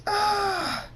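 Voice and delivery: sustained high-pitched scream. A narrator's reading. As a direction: let out a high, drawn scream. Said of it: "Thunderstorm screams".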